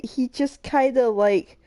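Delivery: normal talking voice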